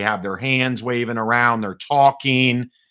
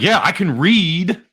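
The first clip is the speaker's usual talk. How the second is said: drawn-out, higher-pitched comeback; slow, mildly sarcastic retort; a little sarcastic; 6 semitones higher than usual. Retold or imitated. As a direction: answer back with light sarcasm. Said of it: "I gave him a little sarcasm back".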